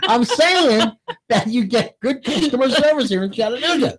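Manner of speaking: laughing. Real, not acted. Still laughing after Tootsie remark.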